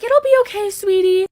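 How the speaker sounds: high, sweet imitation of mom; soothing mom voice. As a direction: coo it like a comforting mother. Mom comforting crying daughter.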